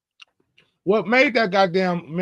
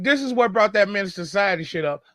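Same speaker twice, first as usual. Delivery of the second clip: ordinary talk, nothing marked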